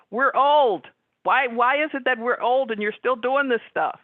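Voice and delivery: high, loud exasperated outburst. Retold or imitated. Sister fed up with her brother's childish teasing, exasperated.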